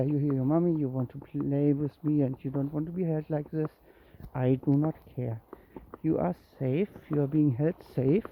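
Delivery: ordinary delivery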